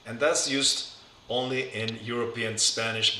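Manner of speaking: usual speaking voice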